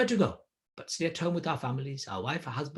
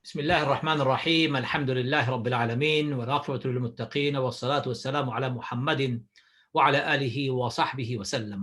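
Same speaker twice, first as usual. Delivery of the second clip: rapid Arabic invocation; much faster than usual. Acted. Preacher opens his talk to listeners with a fast invocation. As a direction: rattle off the opening blessing at speed.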